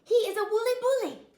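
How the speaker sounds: very high, loud dog voice; little dog voice. A narrator's reading. Chihuahua naming the bully, high loud. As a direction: spell out the menace.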